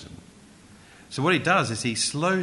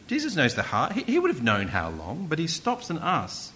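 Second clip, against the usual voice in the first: usual speaking voice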